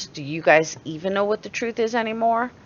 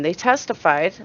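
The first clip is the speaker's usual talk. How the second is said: ordinary talk, nothing marked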